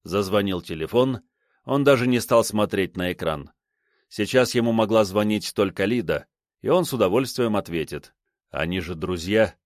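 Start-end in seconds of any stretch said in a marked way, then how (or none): none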